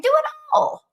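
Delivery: high, bright urging burst; enthusiastic. Retold or imitated. Cheering on a teen unsure of her career, excited. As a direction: throw it out as a rallying cry. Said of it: "I'm like".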